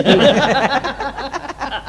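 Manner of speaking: laughing high at the punchline. Real, not acted. Comedian to audience, laughing at own joke. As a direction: burst out laughing.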